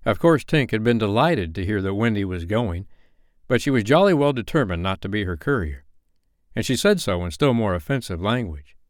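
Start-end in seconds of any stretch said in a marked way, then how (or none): none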